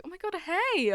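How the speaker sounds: high, soft hello; coy greeting. Retold or imitated. Vlogger re-enacting meeting her dream man, coy. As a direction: greet a crush in a small high voice. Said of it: "And I was like".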